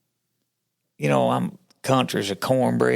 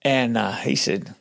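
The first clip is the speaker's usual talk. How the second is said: ordinary delivery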